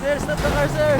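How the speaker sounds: high, loud yell across a distance; loud desperate call after the car; shouting after a car. Acted. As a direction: bellow to make the driver stop.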